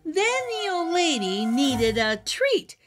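ordinary delivery

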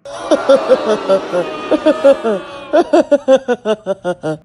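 big laugh. Acted. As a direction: burst out laughing. Host laughing at the joke.

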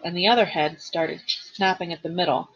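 ordinary delivery, nothing marked

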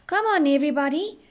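rally the passengers in a storybook shout; train's shout. A narrator's reading. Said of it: "the train shouted".

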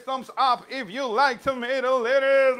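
high, loud call to the class; loud, excited cheerleading; excited rallying. Real, not acted.

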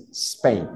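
"Spain" with a light hissed S, no e before it; soft S in Spain. Shown as a demonstration. Teacher modelling a soft S in Spain. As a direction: start "Spain" straight on a soft s. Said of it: "suavizar un poco más la S".

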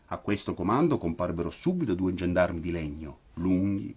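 usual speaking voice

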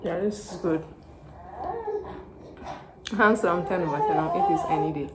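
eating between words; talking while chewing. Real, not acted. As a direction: mouth partly full. Seen 2: Bit chicken just before; mouth working, chicken piece in hand while talking.

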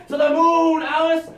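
high loud threatening yell; sitcom husband yelling. Acted. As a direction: bellow like Ralph Kramden. Honeymooners catchphrase.

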